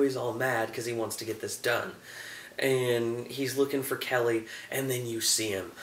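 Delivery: ordinary talk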